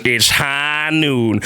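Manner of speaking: very slow drawled cowboy line; lightly voiced gunslinger; imitating McCree. Streamer quoting McCree's ultimate line, slow cowboy drawl. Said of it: "When McCree goes".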